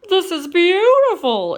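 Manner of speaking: high, loud delighted cry; excited exclaim. Acted. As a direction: burst out in delight. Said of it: "exclaimed Kermit".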